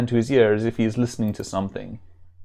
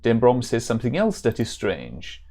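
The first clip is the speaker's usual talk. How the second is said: put-on German-accented warrior; hammed-up German accent. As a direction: play it as an earnest German hero. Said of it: "supposed to be a German accent".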